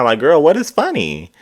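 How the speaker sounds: high incredulous what is funny; exasperated. Real, not acted. Host baffled that Robin laughs, high and incredulous. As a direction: demand an answer, baffled and annoyed.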